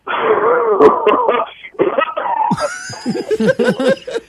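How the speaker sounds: evil villain cackle, loud and rasping; hoarse raspy laugh. Pretend. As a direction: throw back your head and cackle like a smoker. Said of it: "Let me try it again".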